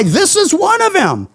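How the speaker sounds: a hint of a tattling servant girl; accusing servant girl. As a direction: tell the crowd he's one of them in a light girl's voice. Servant girl tells the bystanders.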